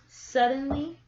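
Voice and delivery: usual speaking voice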